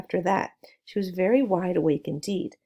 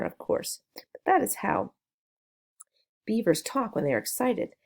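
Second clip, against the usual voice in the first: ordinary delivery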